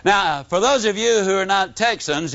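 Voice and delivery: normal talking voice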